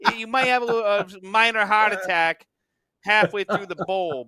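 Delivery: swaggering New Jersey local; boasting about disco fries in a New Jersey accent. Acted. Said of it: "that atypical New Jersey accent".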